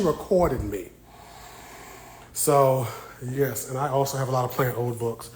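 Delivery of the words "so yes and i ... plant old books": ordinary talk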